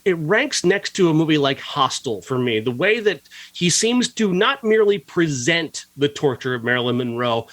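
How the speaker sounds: usual speaking voice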